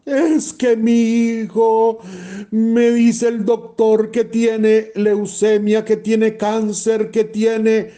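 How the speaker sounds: high, slow, anguished pleading; distraught mother. Acted. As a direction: plead like a frantic mother in shock. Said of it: "Llega una mamá, pegando gritos". (madre angustiada, voz aguda y lenta)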